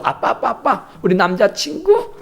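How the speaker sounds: high, giggling daughter; daughter introducing boyfriend. Retold or imitated. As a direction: show off the boyfriend with a laugh.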